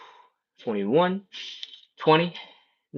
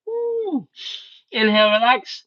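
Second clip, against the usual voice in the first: much higher, tight and effortful, with a whooping release; straining voice; 9 semitones higher than usual. Real, not acted. Instructor at the deepest stretch, straining and whooping. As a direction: squeeze it out at the bottom of the fold. Deepest fold, last round.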